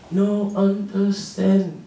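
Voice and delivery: slow, halting non-native "No understand"; slow and unsure, a newcomer with little English; a lightly voiced immigrant boy; broken English. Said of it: "The new kid answered".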